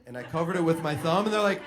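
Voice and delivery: normal talking voice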